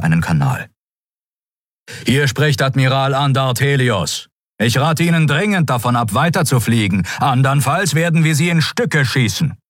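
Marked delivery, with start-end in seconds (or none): 2.0-9.6 higher, firm command voice; stern admiral's warning. A narrator's reading. Admiral warning the enemy ships over an open channel, stern. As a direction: lay down a hard ultimatum.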